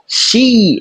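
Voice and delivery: "Xi" long ee, jaw dropped forward; long i demo. Shown as a demonstration. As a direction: stretch the ee in "Xi". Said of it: "a gente fala xi".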